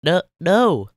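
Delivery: high loud tearful no; sniffly SpongeBob. Acted. SpongeBob denying to Patrick, high tearful protest. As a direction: protest on the edge of tears. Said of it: "a sniffle".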